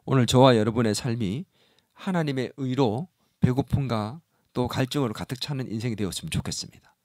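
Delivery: ordinary talk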